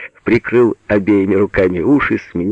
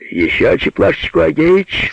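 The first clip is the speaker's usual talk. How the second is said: raised, urgent order; commanding; 9 semitones higher than usual. A narrator's reading. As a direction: order your helper briskly. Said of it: "скомандовал Алёша". (командным голосом)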